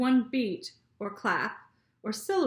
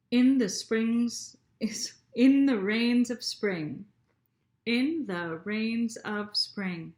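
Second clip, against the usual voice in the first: slow, each syllable of rains of spring tapped out; teacher counting beats aloud; slower than usual. Shown as a demonstration. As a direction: beat out the five syllables. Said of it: "let's read it again and count".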